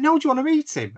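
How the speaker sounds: a high, eager lilt as she offers; excited offer. Retold or imitated. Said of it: "She's like".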